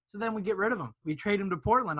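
normal talking voice

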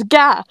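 short, loud "gah" of impact; pained yelp. Acted. Narrator voices Ash hit by a spear, pained yelp. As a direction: yelp as if struck.

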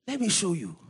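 normal talking voice

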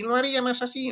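mucho más agudo y algo más fuerte; súbelo bien arriba, más aguda que la otra; Ken Brockman más agudo. Shown as a demonstration. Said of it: "lo haría un poquito más agudo". (voz de Ken Brockman todavía más aguda)

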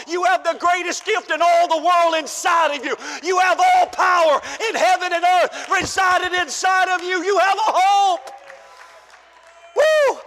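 high, loud shouted preaching; preaching at full force. Real, not acted. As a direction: build to a joyful shout.

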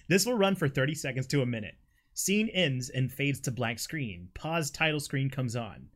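normal talking voice

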